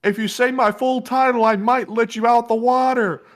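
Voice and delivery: high, far-off mock voice, slowed; comic Anthony. Anthony answering the bystander, high and pompous. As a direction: lord it over them from the statue. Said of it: "Anthony yelled back and said".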